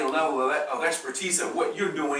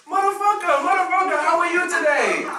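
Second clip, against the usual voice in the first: very high, loud, laughing cheerful greeting; a local man's joyful greeting to a visitor, high and loud with a laugh; mock happy cursing; 12 semitones higher, 5 dB louder than usual. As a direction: beam the curse like a warm hello. African man cheerfully cursing.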